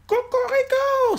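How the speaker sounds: high, slow crow; rooster crow. Acted. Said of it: "Le coq s'envole et crie". (cri de coq aigu et lent)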